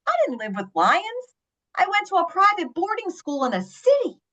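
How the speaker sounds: retold indignant retort; indignant student, imitated. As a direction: set them straight as an affronted student. Student's retort to silly question.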